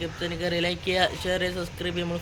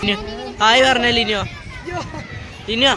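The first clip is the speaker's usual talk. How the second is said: calling out; 6 semitones higher, 3 dB louder than usual. Real, not acted. Calling out to Linu.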